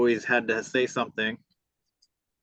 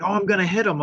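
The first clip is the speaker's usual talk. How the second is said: threatening neighbor lady; 8 semitones higher, 4 dB louder, faster than usual. Retold or imitated. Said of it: "And then she, she's like".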